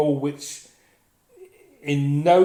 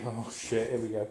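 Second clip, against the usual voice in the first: low, much softer groan of dread under the breath; muttered dread; 4 semitones lower, 8 dB quieter than usual. Retold or imitated. Seeing the friend come back, muttered dread. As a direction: mutter it like doom arriving.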